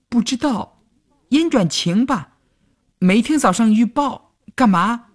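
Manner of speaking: put-on high-pitched young girl, unsure of the answer; hesitant. A narrator's reading. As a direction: answer tentatively in a high girlish voice. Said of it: "犹豫地说". (尖细嗓音犹豫地说)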